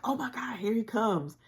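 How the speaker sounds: high startled gasp of fear; reliving the panic as the van creeps up; panicked. Retold or imitated. Seeing the van, a high frightened cry.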